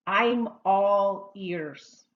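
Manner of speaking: slow, drawn-out teacher demonstration; slow model phrase. Shown as a demonstration. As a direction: stretch each word out for learners to repeat. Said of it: "Why don't you practice that one with me?".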